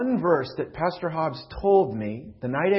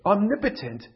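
raised, impassioned preaching; preaching at full force; 5 semitones higher, 4 dB louder than usual. Acted. Pastor preaching loudly to his church, impassioned. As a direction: plead the question aloud to the congregation. Preacher voicing Moses' complaint.

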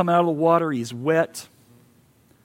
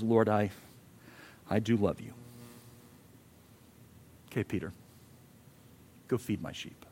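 hushed exchange; 8 semitones lower, 10 dB quieter than usual. Acted. Quiet acted Peter and Jesus dialogue.

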